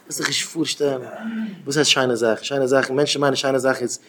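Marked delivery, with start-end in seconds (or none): none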